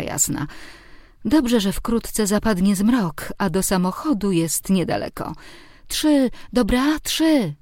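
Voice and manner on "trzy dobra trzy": put-on high, slow little-boy bargaining; high-pitched childish demand; child's voice. A narrator's reading. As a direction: draw it out high like a five-year-old. Boy voiced, asking for three.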